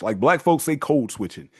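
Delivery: ordinary delivery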